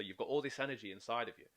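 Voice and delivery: ordinary talk, nothing marked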